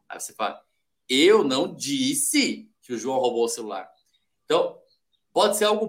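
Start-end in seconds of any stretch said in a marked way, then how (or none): 1.1-3.9 "disse" stretched out and emphasized; stress on DISSE. Shown as a demonstration. Coach showing different interpretations, emphatic stress on "disse". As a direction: lean on "disse", then let the rest drop. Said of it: "falado com tons diferentes".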